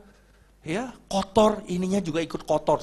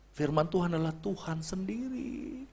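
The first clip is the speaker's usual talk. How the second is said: "Firman Tuhan adalah Tuhan sendiri" said much softer, almost under breath; soft repeat; 12 dB quieter than usual. Shown as a demonstration. Preacher quietly repeating the verse to congregation, soft. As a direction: let the repeat sink in quietly. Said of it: "repeats line softly".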